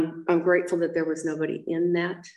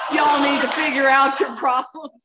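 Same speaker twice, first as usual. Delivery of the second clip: laughing mock quote; 8 semitones higher, 5 dB louder than usual. Mocking the Mormons sending her back.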